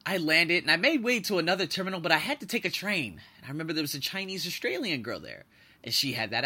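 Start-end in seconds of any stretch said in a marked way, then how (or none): none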